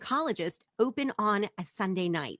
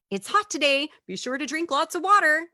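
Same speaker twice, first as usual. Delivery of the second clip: high, loud, sing-out reminder; exaggerated helpful nagging; mock motherly concern; 8 semitones higher, 7 dB louder than usual. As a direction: imitate an over-caring texter.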